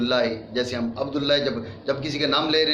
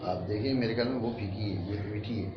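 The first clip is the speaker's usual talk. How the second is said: quiet side remark; 5 semitones lower, 9 dB quieter, faster than usual. Real, not acted. Quiet aside about tea.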